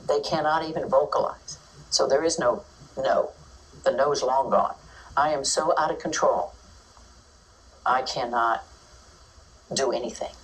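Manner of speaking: ordinary talk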